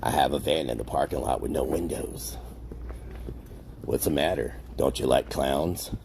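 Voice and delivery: a hint of a creepy clown. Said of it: "Use my clown voice?".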